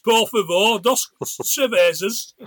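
put-on a Brit abroad ordering beers, English deadpan accent; English tourist accent. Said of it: "like my lot would always do overseas".

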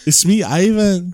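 mock Russian. Playing a Russian Ivan character.

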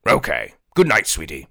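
low, sly courtly voice; a hint of a sly royal schemer; oily scheming vizier.

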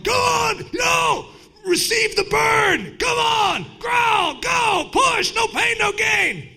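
high, loud coach-style hollering; gym yelling. Retold or imitated. Gym-goers yelling at each other through the burn, hollering. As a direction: bark like a gym trainer. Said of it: "you'll hear people yelling".

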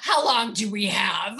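asked through a real laugh. Real, not acted. Guest laughing at the host's question about her relaunches. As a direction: laugh it out, amused at the size of the question.

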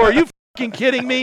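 can't believe he won't shoot; exasperated outburst. Retold or imitated. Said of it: "I go".

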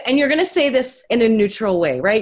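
ordinary talk, nothing marked

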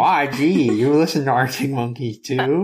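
high, lightly voiced old geezer; hammed-up old man marvelling; mock grandpa voice. Co-host mocks him as an old geezer. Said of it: "That's me, apparently".

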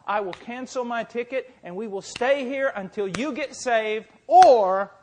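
high, loud, impassioned preacher's cry; full-force preaching. Acted. Preacher retelling his urgent argument with a dying man, full-force preaching. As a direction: preach it at full volume, acting out both sides.

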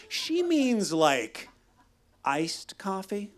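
hesitant, slowed-down backup; drawing out iced coffee unsure; timid slow backup. Retold or imitated. Narrator as weak backup.

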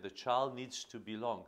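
usual speaking voice

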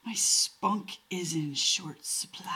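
breathy whisper; barely voiced and tired. Real, not acted. Low-energy reply to rant teasing.

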